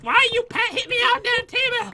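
high-pitched mock complaint; imitated visiting mom. Player mimics the opponent's mom after her visit, mock complaining. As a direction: imitate a mother whining up high. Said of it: "She's like".

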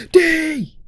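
high, loud shout of a name; acted urgent call. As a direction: yell after someone running off. Calls after fleeing character.

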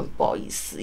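whispered, sheepish apology. Retold or imitated. New courier apologising to the customer in a whisper. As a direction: barely breathe out the sorry. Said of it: "我就跟他说".

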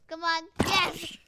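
loud, high bursts of joy; excited cheering. Real, not acted. Gamer cheering as a zombie finally backs off, loud and high. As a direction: yell it out like a win.

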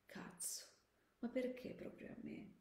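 very soft, stunned near-whisper; soldier's private, sinking dismay; hushed dismay. Acted. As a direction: murmur it in disbelief to yourself. Soldier dismayed at finding the couple.

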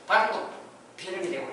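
ordinary delivery

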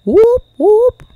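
high, loud rising whoops; mock police siren. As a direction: whoop like a siren, shooting up in pitch. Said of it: "That's the sound of Paw Police".

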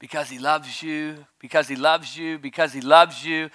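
ordinary talk, nothing marked

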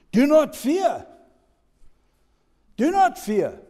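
high, loud proclamation of Jesus' words; Jesus' command proclaimed loud; loud preaching. Acted. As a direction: ring out the command. Jesus voiced, preacher building.

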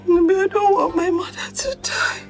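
tearful, broken plea; crying. Acted. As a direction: wail it to a partner through sobs. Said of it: "Stop crying".

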